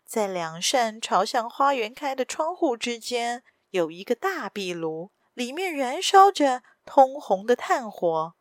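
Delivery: ordinary delivery, nothing marked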